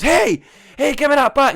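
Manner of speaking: put-on high, loud indignant kid yelling; a boy protesting as his backpack is snatched, shouting high; retold shout. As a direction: yell it like a kid whose bag got grabbed.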